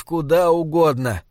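ordinary delivery, nothing marked

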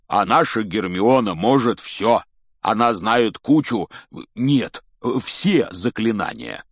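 big, slow, emphatic boast; loud, proud giant; proud exclamation. A narrator's reading. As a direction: brag about her proudly, drawing out key words. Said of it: "гордо воскликнул Хагрид".

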